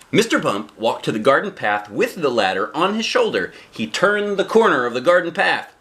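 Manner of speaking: ordinary delivery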